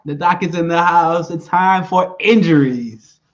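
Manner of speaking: segment announcement. Pretend. Co-host introducing the doc's injury segment, loud announcer hype. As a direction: belt out the intro, stretching the end.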